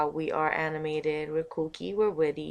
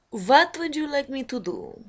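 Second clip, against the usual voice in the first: higher, louder put-on voice; put-on impression voice; 8 semitones higher, 7 dB louder than usual. Shown as a demonstration. Offering impressions to the viewers, in a higher put-on voice. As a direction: pitch it up and push it out. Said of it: "I didn't do too many impressions today".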